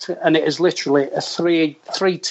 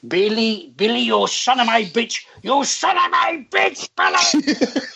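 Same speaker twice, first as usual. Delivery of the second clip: Seve's rant climbing into a high, strained scream; imitated screaming; 7 semitones higher, slower than usual. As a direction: build from high-pitched calling to a drawn-out mock scream. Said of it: "screaming at me".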